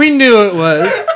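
loud, slowed words through laughter; laughing. Real, not acted. Co-host teases the guest over the impression, laughing. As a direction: still cracking up while talking.

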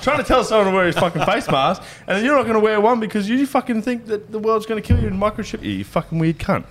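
high, exasperated ranting at the idiot; retold rant. Telling off the safety officer, a mock exasperated rant.

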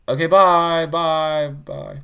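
high, loud sing-song bye; cheery goodbye. Real, not acted. Sign-off goodbyes.

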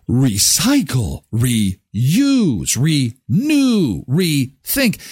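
Recycle, reuse, renew, rethink each said slowly and separately; slow spelled-out list. Shown as a demonstration.

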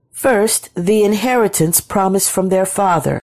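ordinary delivery, nothing marked

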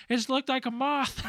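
a high put-on voice for the wife; imitating wife. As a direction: pipe up like the wife butting in. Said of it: "his wife jumps in".

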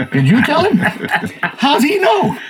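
a startled giant, laughing it out. Retold or imitated. The giant reacting to David's insult, laughing. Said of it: "if I'm the giant, I'm going".

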